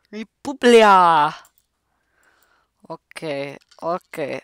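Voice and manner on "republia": very loud, drawn-out "Republia"; exaggerated proclamation. Player repeats passport country, booming exaggerated proclamation. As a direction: belt out "Republia" slowly, stretching the ending.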